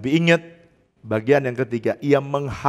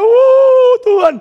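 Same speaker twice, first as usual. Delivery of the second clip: high, loud cry to God; mock panicked wail, drawn out; terrified shout; 9 dB louder, slower than usual. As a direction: shriek it out like a frightened crowd. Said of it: "orang Israel ketakut teriak".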